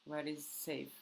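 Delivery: ordinary talk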